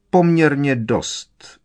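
a touch of brusqueness; curt. A narrator's reading. Skrk answers Pitloun's friendly question, curtly. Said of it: "řekl pan Skrk úsečně".

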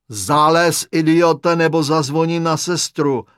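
loud, irritated threat; angry order. A narrator's reading. Patient threatening the intruder from his bed, angry order. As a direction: snap loudly at an intruder.

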